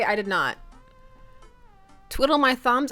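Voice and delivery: ordinary talk, nothing marked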